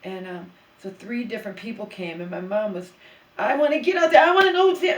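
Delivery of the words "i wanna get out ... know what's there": loud, high mock whine of a pushy elderly mother; a daughter mimicking her mother's loud demands; mother demanding. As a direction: shout it high like mom insisting on the door. Mother demanding to see door.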